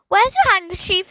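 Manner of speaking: high, loud squawk; parrot voice. A narrator's reading. Parrot nagging Philip after its sneeze, loud squawk. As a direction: squawk the question.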